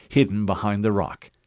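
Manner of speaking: ordinary delivery, nothing marked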